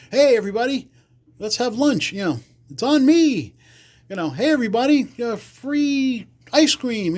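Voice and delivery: high, showy hey everybody call-out; flashy young mobster. Retold or imitated. Johnny calls everyone over, high and showy. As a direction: throw the offer out big, a generous big shot.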